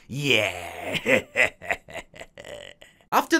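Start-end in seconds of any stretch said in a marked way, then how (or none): 0.1-1.5 much lower menacing yeah and chuckle; deep villain voice. Acted. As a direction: growl it like a gleeful villain. Gleeful reaction after intro.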